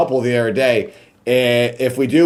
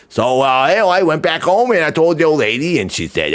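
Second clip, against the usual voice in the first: ordinary delivery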